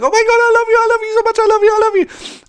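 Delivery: very high, loud squealing fangirl; hammed-up fangirl shriek; gushing teen fan. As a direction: imitate a hysterical fan squealing without a breath. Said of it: "like, this teen fan. She's like".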